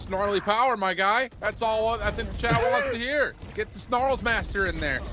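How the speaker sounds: loud, high hype shout; excited hype. Real, not acted. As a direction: pump up the crowd. Hyping a player for chat.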